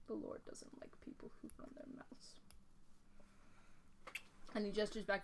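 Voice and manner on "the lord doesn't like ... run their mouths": menacing whisper. Acted.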